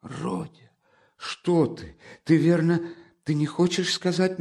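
high, alarmed mother's voice; frightened. Acted. Mother alarmed at what her son said, frightened. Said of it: "начала было в испуге".

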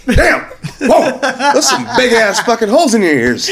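high-pitched amazed outburst, half laughing; imitated yell. Stranger gawking at his ear holes, imitated high yell. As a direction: mimic a loudmouth's astonished yelp. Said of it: "He, he yelled pretty loud".

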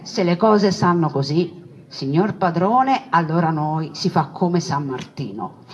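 normal talking voice

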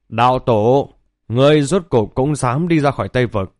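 ordinary delivery, nothing marked

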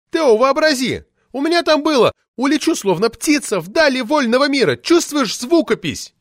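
put-on high, loud indignant shriek of an old party lady; shrill furious official lady yelling; roaring outrage. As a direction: howl it out like an enraged sabre-toothed tigress. Poetess quotes her verse.

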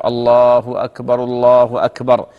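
slow adhan call, both phrases run together; drawn-out takbirs in one breath; takbirs joined together. Shown as a demonstration. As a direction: link الله أكبر straight into الله أكبر without a pause. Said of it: "يجمع التكبيرة".

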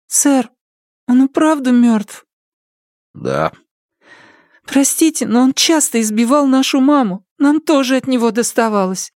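put-on high timid girl, apologetic; young girl voice. A narrator's reading. Girl Kira voiced by narrator.

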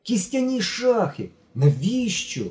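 astonished outburst; amazed. A narrator's reading. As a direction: marvel at it. Genka amazed at chess sale.

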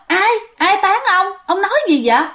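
high-pitched bewildered wife, acted; startled questioning. A narrator's reading. Wife just woken, asking husband who slapped him, startled. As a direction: ask in puzzled alarm, voice jumping up.

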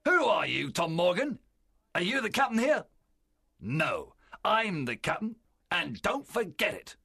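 loud, high-pitched pirate captain; angry challenge. A narrator's reading. Pirate captain confronts a challenger, angrily asserting command. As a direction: put the challenger firmly in his place.

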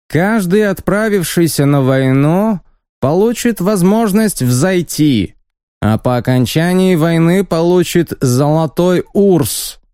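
high, squeaky sales pitch; haughty envoy promising gold. Acted. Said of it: "высоким, громким и совсем немного писклявым голосом".

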